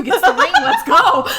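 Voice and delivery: high, loud, laughing challenge; mock fight challenge. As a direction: rise into a playful battle cry.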